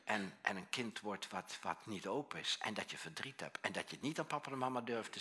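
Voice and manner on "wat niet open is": ordinary talk, nothing marked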